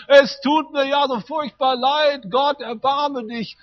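high, loud, slow anguished cry. Retold or imitated. Man on his knees at the minibar crying out to God in anguish. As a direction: wail it out to God, begging for mercy. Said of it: "fällt auf seine Knie ... und schreit".

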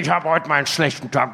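fast, low mock grumble; annoyed grumbling. Homeless Klaus complains to the pastor, mock annoyed grumbling. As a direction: rattle it off like a grouch, imitated. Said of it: "er war total ärgerlich".

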